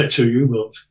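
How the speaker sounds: normal talking voice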